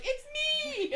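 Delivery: very high squealed shout, laughing; giddy excitement. Retold or imitated. Host answers fan with no clue what's happening, giddy. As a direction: shriek it back with mock-star delight. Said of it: "you were so excited".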